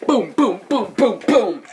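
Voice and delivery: loud punchy boom boom boom; mock gunshots, punched out loud; gunfire booms. Gunfire sound effects.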